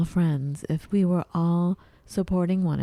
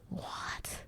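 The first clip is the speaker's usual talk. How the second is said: stunned whisper; 16 dB quieter than usual. Retold or imitated. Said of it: "'cause I'm like".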